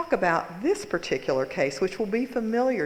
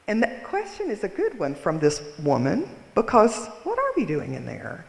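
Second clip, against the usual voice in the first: ordinary delivery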